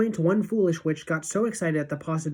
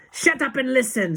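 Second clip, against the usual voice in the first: high, loud snapped order; Grand High Witch snap; 9 semitones higher, 6 dB louder than usual. A narrator's reading. Witch leader silencing her followers, sharp loud snap. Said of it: "snapped the Grand High Witch".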